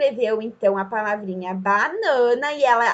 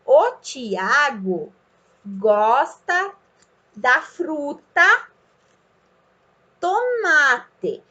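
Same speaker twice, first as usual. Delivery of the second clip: slow, word by word with pauses; modelled reading; much slower than usual. Shown as a demonstration. Child first reads the phrase with the picture, slowly word by word. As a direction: read like a beginner, stretching each word. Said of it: "a criança primeiro vai ler a frase".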